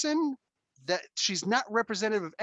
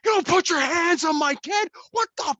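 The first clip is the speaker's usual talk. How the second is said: high furious yelling; angry parent shouting in a store; power voice yell; 10 semitones higher, 6 dB louder than usual. Retold or imitated. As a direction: scream it at the stranger who shoved your kid. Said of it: "the power voice".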